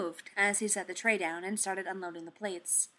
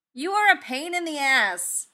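a high, loud exasperated yell; 8 semitones higher, 8 dB louder than usual. A narrator's reading. Tony yelling at his bot across the workshop, loud and high. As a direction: shout it across the room at the robot. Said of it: "Tony yelled across the workshop".

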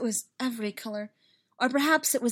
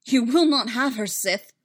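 loud, forceful higher shout; defiant shout; 3 semitones higher, 8 dB louder than usual. A narrator's reading. The light shouting down the Sith, forceful shout. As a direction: shout it like a challenge. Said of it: "And a shout".

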